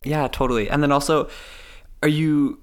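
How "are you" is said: normal talking voice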